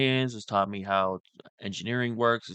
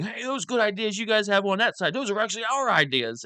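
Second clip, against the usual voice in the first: mocking impression, pitched way up; Cenk; 10 semitones higher than usual. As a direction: parody him in a high, eager voice. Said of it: "now Cenk is saying".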